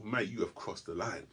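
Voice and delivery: ordinary delivery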